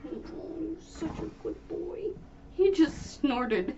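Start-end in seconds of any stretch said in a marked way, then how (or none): 0.1-2.0 high hushed dog praise; talking to a dog. Real, not acted. Doting whisper to dog.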